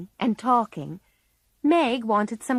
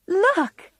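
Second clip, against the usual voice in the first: high bright call to her sister; excited; 12 semitones higher, 4 dB louder than usual. Acted. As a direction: burst out with news, much higher. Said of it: "said Meg excitedly".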